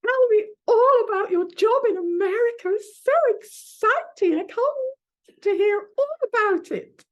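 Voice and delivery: high, loud, thrilled aunt voice; gushing auntie. Retold or imitated. Ex-actress aunt eager to hear about the America job, high and loud. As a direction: gush with excitement.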